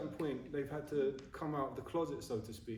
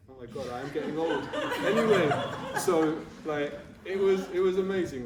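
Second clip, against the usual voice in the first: louder, laughing through the words; laughing at himself; 4 semitones higher, 9 dB louder than usual. Real, not acted. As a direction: burst out laughing as you admit your age. Laughing about getting old.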